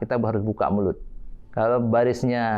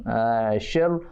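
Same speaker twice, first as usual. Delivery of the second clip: ordinary delivery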